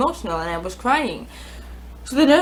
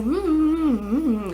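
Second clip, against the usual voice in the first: muffled mumbling with no words, rising then falling; imitated blur of talk; fuzzy voices; 6 semitones higher, slower than usual. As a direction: hum like indistinct far-away voices. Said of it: "voices began to get fuzzy".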